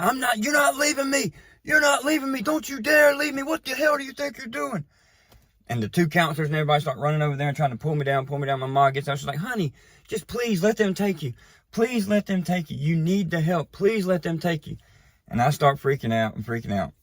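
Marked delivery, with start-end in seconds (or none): 0.0-4.8 high frantic yelling at mom; retold screaming. Son on top of mom's car, high desperate yelling. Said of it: "I'm sitting on top of her car screaming". 9.4-14.8 a hint of a pleading mother, pitched higher; pleading mom. Mom begging son to accept rehab, lightly voiced higher plea.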